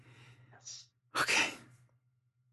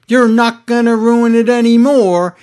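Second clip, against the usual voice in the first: Drew quote; 6 semitones higher, 4 dB louder than usual. Retold or imitated. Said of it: "And then Drew's like".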